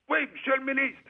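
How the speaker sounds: high, clipped soldier's reply to a superior; soldier answering. Retold or imitated. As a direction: bark it up high like a para at attention. Para answers minister.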